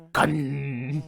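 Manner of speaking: loud, high coin voice; odd humming put-on voice; talking coin. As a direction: stretch out the final n.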